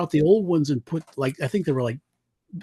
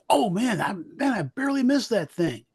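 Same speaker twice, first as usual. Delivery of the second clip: mock-relieved outburst, lightly acted and higher; imagined helmsman; 7 semitones higher than usual. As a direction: play a helmsman after a near miss. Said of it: "have the helmsman say".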